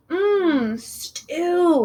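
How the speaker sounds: high, longing mmm stew; dreamy sigh. A narrator's reading. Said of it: "he sighed".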